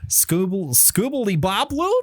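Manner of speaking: high Sims-style gibberish question; Simlish gibberish. Acted. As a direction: babble like a Sim asking something. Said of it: "voices in every Maxis game sound like that".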